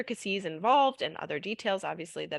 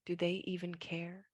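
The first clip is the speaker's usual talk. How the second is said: whispering; 10 dB quieter than usual. Acted. Whispered line in the poem.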